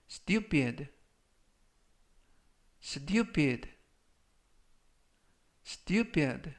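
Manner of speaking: "stupid" three times, long pause after each; slow model reading. Shown as a demonstration. Teacher reviews vocabulary for followers, slow model reading. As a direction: read out "stupid" slowly for learners to repeat. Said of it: "천천히 세 번씩 읽어드리고요".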